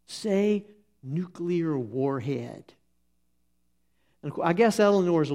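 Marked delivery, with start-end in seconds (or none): 0.0-2.7 slow, drawn-out coaxing; slow, teasing prompt to a toddler; coaxing dad. Retold or imitated. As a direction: stretch each word out to tempt the child. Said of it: "He says".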